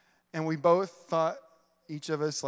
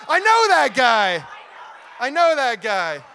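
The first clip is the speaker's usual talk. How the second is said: higher, louder, slow overjoyed shout; Elf excitement; 8 semitones higher, 14 dB louder than usual. Pretend. Pastor imitating Elf's overjoyed yell to the crowd. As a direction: shout like Buddy the Elf meeting Santa. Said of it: "this is gonna be like Elf Jesus".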